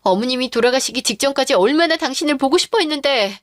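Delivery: normal talking voice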